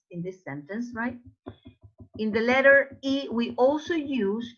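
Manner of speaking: ordinary delivery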